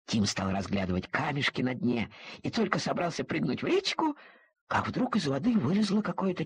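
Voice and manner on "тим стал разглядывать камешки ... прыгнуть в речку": ordinary delivery, nothing marked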